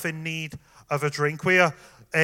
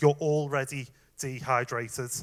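ordinary talk

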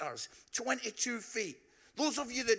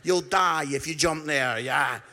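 imagined guide; 11 dB louder than usual. Retold or imitated. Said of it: "I hope he's not going".

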